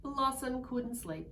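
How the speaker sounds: plain reading voice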